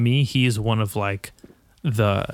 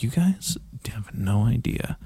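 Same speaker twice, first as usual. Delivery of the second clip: whisper. Retold or imitated. Marvelling at friends' cable TV.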